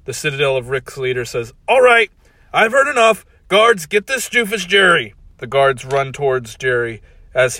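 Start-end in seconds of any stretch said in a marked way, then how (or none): 1.7-5.1 high, loud command; commanding order. Acted. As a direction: bark orders at the guards. Leader orders guards.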